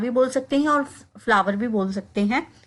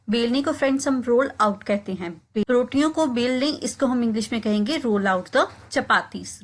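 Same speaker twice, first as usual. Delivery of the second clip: ordinary talk, nothing marked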